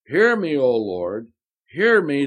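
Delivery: impassioned prophet's prayer; fervent plea. Acted. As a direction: cry out to the Lord in earnest. Elijah's fervent prayer voiced.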